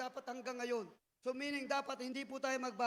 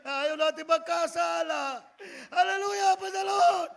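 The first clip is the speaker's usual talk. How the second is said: crying praise; 3 semitones higher, 10 dB louder, slower than usual. Real, not acted. Preacher praising God before the congregation, crying out loud. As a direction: cry out to the Lord.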